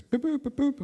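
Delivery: high mock beeping of a calendar reminder; phone alarm beeps. Calendar app beeping a reminder at the husband, high mock beeps. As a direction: chirp it like a phone alarm going off. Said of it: "So it says".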